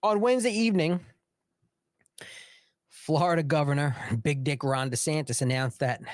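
ordinary delivery

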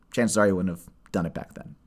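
usual speaking voice

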